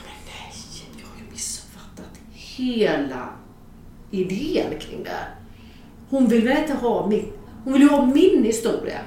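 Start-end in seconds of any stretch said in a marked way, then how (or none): 0.0-5.3 slow, hushed whisper of dismay; whispered realisation. Retold or imitated. Writer realising she misunderstood the whole idea, aghast whisper. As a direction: whisper it slowly as the penny drops. Sudden horrified realisation, retold.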